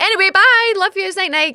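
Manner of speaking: high, sing-song hurried goodbye; breezy farewell. Retold or imitated. Daughter escaping awkward parents, high over-cheery goodbye. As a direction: chirp it to cut the talk short.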